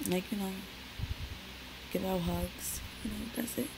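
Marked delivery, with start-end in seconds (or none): none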